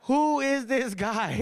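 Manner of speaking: high, slow mock denial; drawn-out Indian-accented disowning; scared dad. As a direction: play a cowed dad pleading ignorance. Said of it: "he's scared of my mom".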